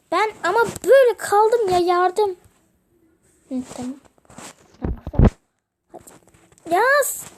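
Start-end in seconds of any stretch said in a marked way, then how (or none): 0.1-2.3 high, loud pretend panic calling for help; a hammed-up little cry of being stuck; mock cry for help. Stuck in the game, begging friends for help in mock panic. 6.7-7.2 a high, loud little victory cheer. Real, not acted. Celebrating a small win in the game with a loud cheer.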